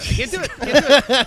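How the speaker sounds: ordinary talk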